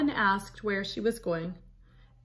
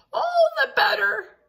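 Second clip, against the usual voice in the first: high, loud granny-wolf voice; wolf as grandma; 6 dB louder than usual. Acted. Wolf pretending to be grandma to Red, high put-on voice. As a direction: squeak it out like a wolf faking a grandma.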